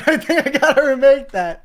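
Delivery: loud, high laughing reply. Real, not acted. Guest laughing loudly while agreeing he must remake the clip, real laughter.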